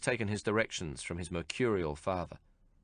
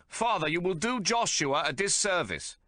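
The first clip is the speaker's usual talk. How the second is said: high, raised warning to a deaf father; shouting into ear; 10 semitones higher than usual. A narrator's reading. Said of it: "Abraham shouts into David Solomon's ear".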